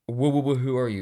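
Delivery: loud, overblown mock-Wattpad delivery; mock dramatic. Shown as a demonstration. Reading the fanfic aloud, mocking its drama with a loud, overblown line. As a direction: ham up the cheesy fanfic line. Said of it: "because we have to talk like Wattpad".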